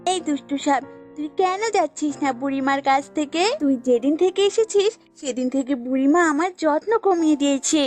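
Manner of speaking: lightly voiced goat, resentful. Goat blames snake for lost care, mildly cross. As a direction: pick a quarrel, mildly cross.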